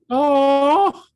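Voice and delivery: long, high "oh"; melting "ohhh"; delighted coo. Retold or imitated. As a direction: let out a drawn-out high coo. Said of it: "I was like".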